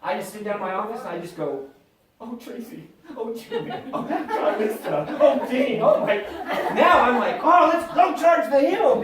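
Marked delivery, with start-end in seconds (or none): 2.2-6.2 mock lament. Lamenting names in office. 7.4-9.0 high, loud, slow rallying call; mock heroic battle cry. Rallying himself.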